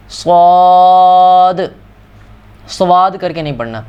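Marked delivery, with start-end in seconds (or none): 0.1-1.6 صاد slightly thick, not like س; صاد medium-heavy and long; heavy saad. Shown as a demonstration. Teacher modelling صاد, medium heavy. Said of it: "صرف اسی طرح سے پڑھنا ہے".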